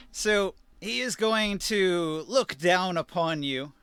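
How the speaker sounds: ordinary talk, nothing marked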